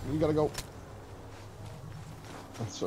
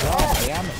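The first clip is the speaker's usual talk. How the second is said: frustrated; 6 semitones higher, 6 dB louder than usual. Real, not acted. Landed badly, frustrated outburst.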